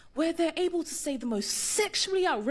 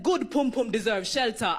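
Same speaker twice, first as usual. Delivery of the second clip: low Jamaican patois compliment; deep, tender Jamaican patois; Winston's line; 6 semitones lower than usual. Retold or imitated. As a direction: say it like a smooth Jamaican sweet-talker. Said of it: "Winston turned to me and said".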